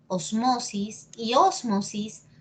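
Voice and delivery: osMOsis, then ÓSmosis, slowly; slow demonstration of both stresses of osmosis; two accepted stresses. Shown as a demonstration. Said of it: "depende de ti cómo lo quieras decir".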